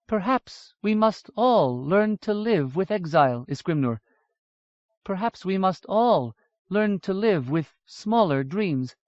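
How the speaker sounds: slow lightly voiced elf, resigned and tender; wistful, slow. Aditu, wistful advice.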